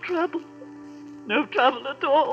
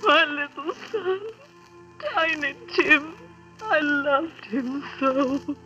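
tearful grief; slower than usual. Acted. Grieving mother over dead son.

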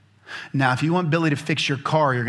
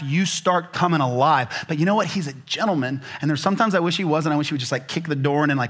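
ordinary talk

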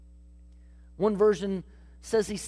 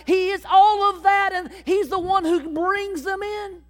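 building louder and higher toward a proclaiming peak; preaching crescendo; 4 semitones higher, 4 dB louder than usual. Acted. Pastor proclaims Jesus the good shepherd, rising preaching fervor. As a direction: rouse the congregation to the good shepherd.

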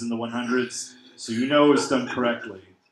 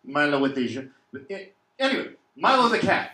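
put-on French accent; 2 semitones higher than usual. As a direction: say the name like a Frenchman.

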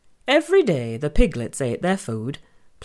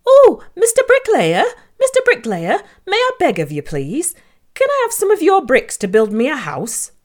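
squeaky little pig, pleading; high piglet voice; 9 semitones higher, 5 dB louder than usual. Acted. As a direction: beg sweetly in a high piggy voice. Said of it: "asked Harry".

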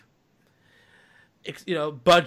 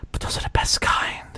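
Andrew Garfield. Retold or imitated. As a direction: whisper it like a shy flirt. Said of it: "let me put on my best Andrew Garfield".

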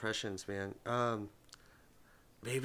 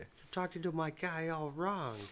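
higher, softer, slow quoted line; movie character impression; 5 semitones higher, 5 dB quieter than usual. Quoting a guy from Joe Dirt, soft drawn-out voice. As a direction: drag it out quietly in a character voice. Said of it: "I'll say it real quiet".

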